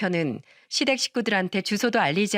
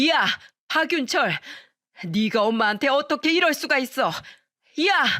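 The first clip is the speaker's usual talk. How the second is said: shrill outraged yell; 5 semitones higher than usual. A narrator's reading. Mother-in-law screams at her son through the locked door, outraged. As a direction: bellow his name in disbelief.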